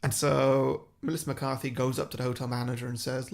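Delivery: ordinary delivery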